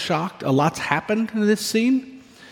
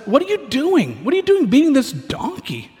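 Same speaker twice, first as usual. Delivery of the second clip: indignant angel; 9 semitones higher than usual. Acted. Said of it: "And the angel says, like".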